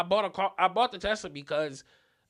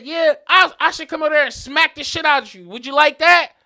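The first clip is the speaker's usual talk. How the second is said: high, very loud challenge; heated, pushed yell ending in a dare; retold angry threat; 8 semitones higher, 11 dB louder than usual. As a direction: shout him down with a bigger threat. Acted screaming match, threat back.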